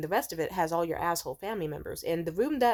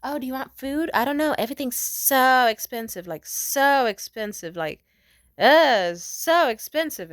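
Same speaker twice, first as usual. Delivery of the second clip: higher, louder, whiny mock-fretting over cost; passive aggressive; 5 semitones higher, 10 dB louder than usual. Imitating ex-boyfriend complaining about food prices, passive aggressive. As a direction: mimic a stingy ex fussing about prices. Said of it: "like, passive aggressive".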